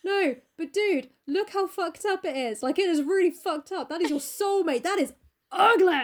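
hammed-up urgent dismay; appalled Basil. Painter telling Dorian his soul is ugly, appalled.

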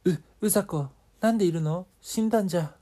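high, loud frightened gasping lines; startled fright. Acted. Ayaka sees the dead Uzako's ghost, in high loud fright. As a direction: recoil from a ghost you thought was dead.

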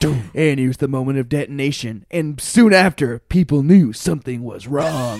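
solemn narration, drawing out the words; video narrator. Retold or imitated. Retelling a caught-on-video show to friends, mock narrator voice. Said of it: "the narrator guy's like".

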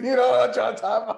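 laughing out loud, fast and loud; high, loud laughing question. Real, not acted. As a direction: blurt it through a big laugh. Laughing hard at own joke.

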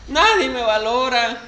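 hammed-up weepy lament, a touch of laughter; mock crying woman. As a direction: stretch every line into a self-pitying wail. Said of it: "Y que vuelve a chillar".

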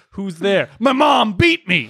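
high, slow mock-rapper outcry; hammed-up aggrieved rapper voice. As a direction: wail a knock-knock punchline, laughing through it. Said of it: "He was like".

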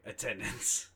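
ordinary delivery

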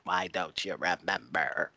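slow, soft put-on voice; mock impression of her. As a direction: imitate her slowly, dropping the volume. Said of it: "she sounded like this".

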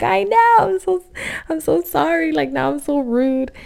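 high-pitched, sheepish apology; mock apologetic whine. Acted apology to mother-in-law's teasing.

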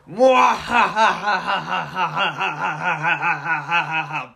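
slow, loud evil mwahaha; mad scientist cackle. Acted. Villain laugh after losing.

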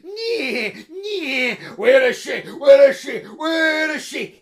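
loud, high calls of a hunting party; hunters shouting. A narrator's reading. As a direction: bellow for the runaway bride. Bride hunters arrive shouting.

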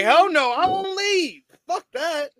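high-pitched defiant outburst, hammed up; mock indignant refusal. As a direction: play an outraged wrestler refusing, pitch jumping up. Acting out the wrestler's angry refusal.

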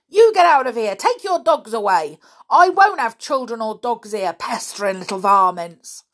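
loud, high furious yell; angry shout. A narrator's reading. Old caretaker yelling at children to get out, angry. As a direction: drive the kids off with a shout.

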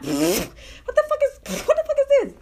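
stunned what the fuck; shocked outburst. Retold or imitated. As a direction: react in disbelief.

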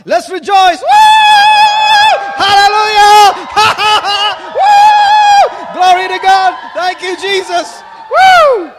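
high, loud whoops of praise; jubilant shouting. Real, not acted. Rejoicing loudly with the congregation.

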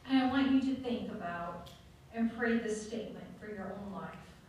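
ordinary talk

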